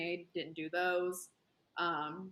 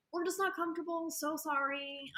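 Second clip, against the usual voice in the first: high, sugary Southern polite apology; Southern politeness; 9 semitones higher than usual. Retold or imitated. Turning down the creepy guy, high sweet Southern politeness. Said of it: "I'm being Southern".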